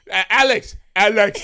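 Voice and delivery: loud, high call across the house; dad calling Alex. Retold or imitated. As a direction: call out to the brother like dad did. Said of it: "why he said it like that".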